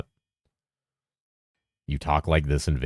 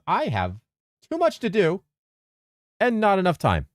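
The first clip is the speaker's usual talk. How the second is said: squeaky, mock-despairing high voice; high dramatic whine.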